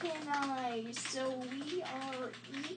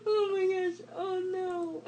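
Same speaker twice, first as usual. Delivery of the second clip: laughing; 6 semitones higher, 5 dB louder than usual. Real, not acted. Laughing fit.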